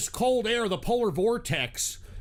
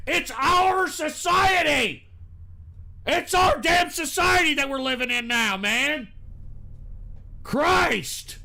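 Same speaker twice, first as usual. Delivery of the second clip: loud, higher, slow furious shout; angry shouting; 5 semitones higher, 4 dB louder than usual. Real, not acted. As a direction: shout it at the whole chat. Heated rant.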